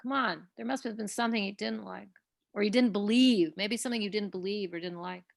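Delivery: usual speaking voice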